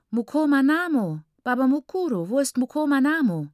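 put-on high, loud child's eager question; a little girl tugging at her uncle. Small girl hugging uncle asks where her brother is, high and eager.

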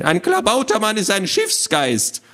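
high, lecturing little creature; squawky know-it-all. Acted. Little man explains gnomes to children, squawky lecturing. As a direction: lecture them in a fussy squawk. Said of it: "eine quäkende Stimme".

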